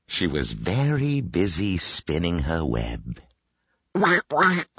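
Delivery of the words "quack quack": high imitated quacks; duck's quack. A narrator's reading. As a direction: quack like a duck. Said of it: "called the duck".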